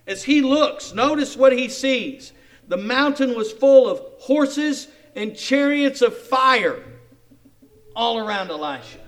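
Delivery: loud, fervent reading of the prayer; full-force preaching. Acted. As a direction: proclaim the verse to the crowd. Proclaims the vision again.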